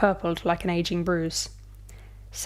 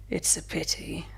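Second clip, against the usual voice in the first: barely-there whisper, much softer; murmured; 7 dB quieter than usual. A narrator's reading. Vampire pinning Thea down, murmuring in a whisper. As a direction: breathe it into her neck. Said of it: "he murmured".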